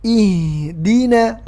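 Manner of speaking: slow "Ihdina" with a clear i; correct i vowel. Shown as a demonstration. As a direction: open with a pure kasrah i.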